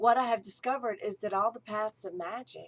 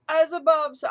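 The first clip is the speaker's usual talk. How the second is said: put-on a higher, louder stab at an English accent; attempted English accent; 7 semitones higher, 9 dB louder than usual. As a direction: try the teacher's English accent and break off. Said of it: "in an English accent that I can't quite do".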